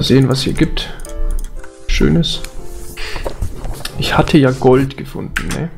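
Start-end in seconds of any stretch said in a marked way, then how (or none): none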